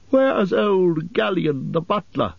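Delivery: high, slow, drawn-out call; high-pitched elf calling across the cellar. A narrator's reading. Elf calls out for the missing butler.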